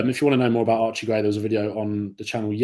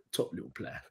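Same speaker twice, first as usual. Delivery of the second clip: whispered echo; 5 semitones lower, 15 dB quieter than usual. Shown as a demonstration. Echoing his own praise of the midfielder, whispered. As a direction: whisper "Top little player." softly, breathy, no voice.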